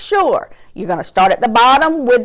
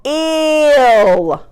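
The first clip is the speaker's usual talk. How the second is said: "B-ill" split, ILL held long and higher; slow motion; 4 semitones higher than usual. Shown as a demonstration. Teacher doing the roller coaster word, in slow motion. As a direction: stretch BILL over the hill. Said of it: "I do it in slow motion".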